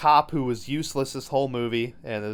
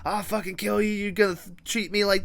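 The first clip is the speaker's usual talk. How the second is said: higher-pitched angry snarl, lightly voiced; furious doll reveal; 6 semitones higher than usual. Doll revealing itself, threatening the mom with higher mock rage. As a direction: threaten like a doll suddenly come alive. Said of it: "Brad Dourif being like".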